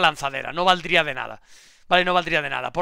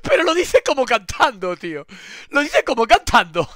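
says it through real laughter, higher and louder; laughing; 5 semitones higher, 7 dB louder than usual. Real, not acted. Laughing to chat about the reporter singing. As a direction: giggle out the words.

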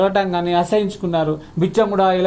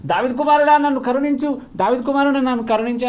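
shouted plea; 6 semitones higher than usual. Retold or imitated. Blind beggar shouting to Jesus despite the crowd hushing him, high cry fading. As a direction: keep crying out though they hush you. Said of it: "అరవద్దు అంటే కూడా నేను అరిచాను".

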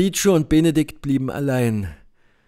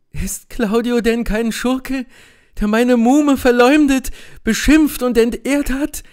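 much higher, louder, indignant cry; outraged cry; 10 semitones higher, 4 dB louder than usual. Acted. Beatrice to Benedikt, accusing Claudio: high, loud outraged cry. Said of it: "rief Beatrice aus".

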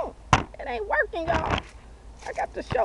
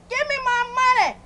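angry demand; 10 semitones higher, 4 dB louder than usual. Acted. Yelling at the shoe store.

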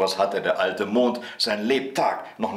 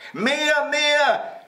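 put-on high, piercing little boy's yell; shrieked demand; 5 dB louder than usual. A narrator's reading. Häwelmann demands more rolling, high shriek. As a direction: squeal it out like a greedy child. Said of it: "schrie Häwelmann".

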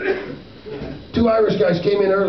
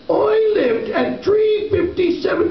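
high, giggling Irish reply; laughing punchline; 11 semitones higher than usual. Real, not acted. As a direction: laugh through the line in a mock brogue.

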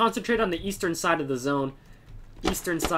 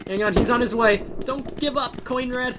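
urgent encouragement; 6 semitones higher than usual. Real, not acted. Urging downed teammate.

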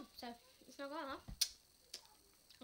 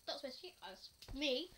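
ordinary talk

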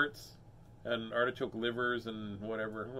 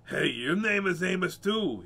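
loud, slow, raised captain voice; higher, drawn-out storybook captain; captain character; 6 semitones higher, 6 dB louder than usual. Acted. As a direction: proclaim it slowly as the captain. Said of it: "he said".

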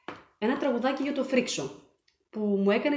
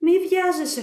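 teammates holding back; 8 semitones higher, 6 dB louder than usual. A narrator's reading. The team tells Frixos to wait, raised and urgent. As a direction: rein him in quickly. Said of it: "του πρότειναν οι υπόλοιποι".

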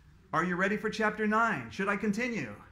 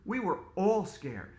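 plain reading voice

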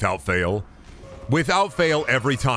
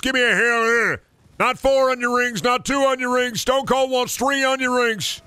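high-pitched wrestler promo voice; hyped catchphrase rant, every phrase held high; Stone Cold; 11 semitones higher than usual. Acted. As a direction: cut a pumped-up wrestling promo, strained and high. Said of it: "Stone Cold don't want no Elden Ring".

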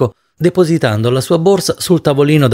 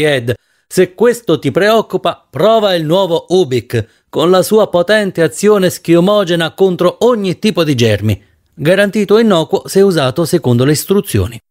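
advert pitch; 5 semitones higher than usual. Acted. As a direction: sell it like a TV ad. Ubik advert speech.

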